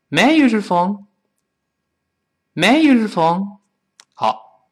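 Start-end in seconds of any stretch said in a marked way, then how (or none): 0.1-3.6 quick and higher, "use your" run together as yu-zher; faster, linked. Shown as a demonstration. Teacher speeds up the phone question, faster and linked. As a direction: breeze through it in one go. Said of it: "再快点".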